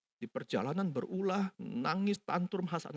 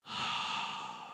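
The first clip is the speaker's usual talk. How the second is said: deep sigh; 9 dB quieter than usual. Retold or imitated. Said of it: "Si papa tarik nafas".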